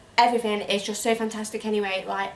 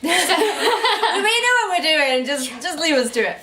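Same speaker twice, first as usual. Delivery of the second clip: laughing; 4 semitones higher, 4 dB louder, slower than usual. Real, not acted. Both giggling at themselves.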